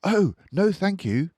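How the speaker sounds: put-on a girl who won't let go of her bear; little girl, loud. A narrator's reading. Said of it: "Lisa answered".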